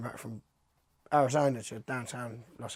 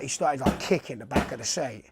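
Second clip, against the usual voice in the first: ordinary talk, nothing marked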